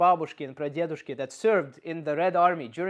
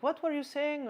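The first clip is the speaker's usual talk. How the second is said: mock innocent; 10 semitones higher than usual. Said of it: "be like".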